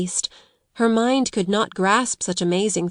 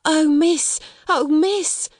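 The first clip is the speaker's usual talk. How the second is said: high, frightened "Oh, miss!"; frightened stutter; 8 semitones higher, much slower than usual. A narrator's reading. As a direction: blurt it out, scared of being caught. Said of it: "she stuttered".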